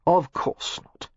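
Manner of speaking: slow, muffled, mouth pressed against her. A narrator's reading. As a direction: speak with your face pressed into someone. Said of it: "his voice muffled in her cleavage".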